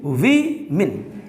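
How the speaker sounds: विमेन very slow, wi, then min; syllable-by-syllable pronunciation. Shown as a demonstration. Teacher breaks the word down for learners, slow wi-min. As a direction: split विमेन into two drawn-out beats. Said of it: "इसको बोला जाता है".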